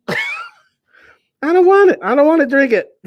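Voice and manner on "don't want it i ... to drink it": high, loud whining refusal; disgusted whine. Real, not acted. Disgusted by the smell.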